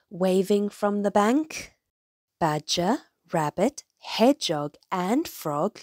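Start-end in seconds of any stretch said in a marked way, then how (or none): none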